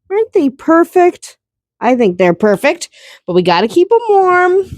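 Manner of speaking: high cooing to tiny chicks. Real, not acted. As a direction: gush over the babies. Cooing over newborn chicks.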